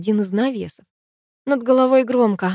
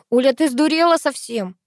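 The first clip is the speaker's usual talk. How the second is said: higher-pitched indignant outcry, not full volume; mild acted yell; 7 semitones higher, 4 dB louder than usual. A narrator's reading. Sister scolds the narrator, mildly yelling. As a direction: cry out in disbelief, held back. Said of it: "завопила сестра".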